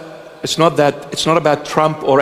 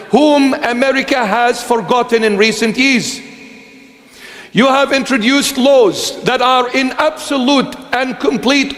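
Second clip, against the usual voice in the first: preaching at full force; 5 semitones higher than usual. Acted. Preacher warning the people of America, in a raised full preaching voice. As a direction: thunder a warning at the nation like a prophet.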